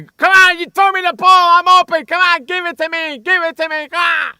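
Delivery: loud, high demanding shout; yelling and screaming. Retold or imitated. Imitating the player yelling at Orton on the sideline, frantic screaming. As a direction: scream for the ball like an enraged receiver. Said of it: "start yelling and screaming".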